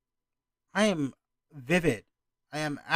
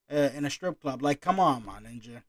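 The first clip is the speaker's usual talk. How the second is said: ordinary talk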